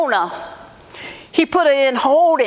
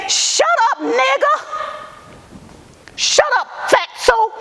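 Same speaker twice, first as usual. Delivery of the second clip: much higher, biting retold yell; reliving the sheriff's abuse in a sharp high voice; sheriff's angry yell; 10 semitones higher than usual. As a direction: spit it out like the sheriff did. Retold sheriff yelling at her.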